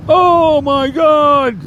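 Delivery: very high, drawn-out mock exclamation; mock shocked gasp. Podcaster mimicking chat trolls reacting to a name, hammed-up shock. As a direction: stretch it out like a scandalised troll.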